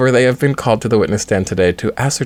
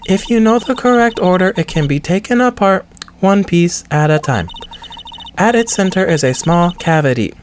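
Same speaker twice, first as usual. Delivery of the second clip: higher, lightly voiced lady witness; high put-on woman's voice; 8 semitones higher than usual. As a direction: play the poised witness.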